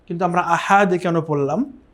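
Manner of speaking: usual speaking voice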